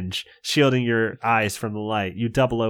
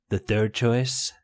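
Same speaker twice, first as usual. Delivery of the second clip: solemn announcement; 4 semitones lower, 5 dB quieter than usual. Acted. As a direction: announce it solemnly, dropping low. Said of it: "Gabe announces solemnly".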